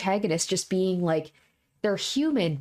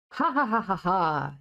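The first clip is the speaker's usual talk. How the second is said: idiotic laugh. Acted. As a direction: laugh like an idiot instead of yelling. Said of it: "laugh like an idiot".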